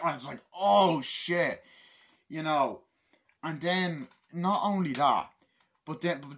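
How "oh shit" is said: loud, high outburst of alarm; mock shocked shout. Viewer reacting to the plane scene, mock shocked shout. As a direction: blurt it out loud, imitating shock.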